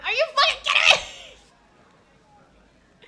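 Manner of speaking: high, loud, laughing disbelief; laughing outrage. Real, not acted. Streamer reacting to the game, loud laughing disbelief. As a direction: shriek it through a laugh.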